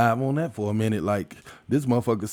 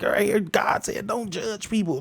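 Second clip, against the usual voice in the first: higher, preachy mocking voice; mock Bible quoter; 8 semitones higher than usual. Mocking people who crack the Bible open, high preachy voice. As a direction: parody someone reading scripture at you. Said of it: "be like".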